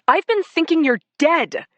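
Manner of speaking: high, loud anguished shout. A narrator's reading. Lindsay shouts at her missing husband, high and loud. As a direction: yell it at him, raw. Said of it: "I shouted".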